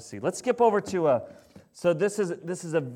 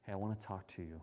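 much softer, low parent's voice; lowered voice; 10 semitones lower, 18 dB quieter, faster than usual. Shown as a demonstration. Parent to teenager, softly lowered voice. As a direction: drop the voice right down to get attention. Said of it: "try lowering your voice".

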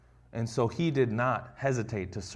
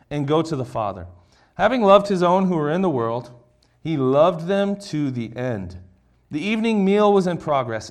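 raised, declaiming Bible reading, slowing at the end; scripture reading; 5 dB louder than usual. Acted. Pastor reading John to the congregation, raised and declaiming. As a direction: read the passage out with weight.

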